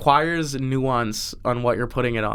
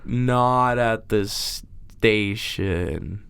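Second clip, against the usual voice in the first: very slow, stretched words; slow motion; slower than usual. Pretend. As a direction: drag every word out in slow motion. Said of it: "Now you say in slow motion what you said before".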